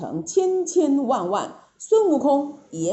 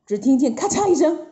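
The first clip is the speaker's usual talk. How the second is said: ordinary delivery, nothing marked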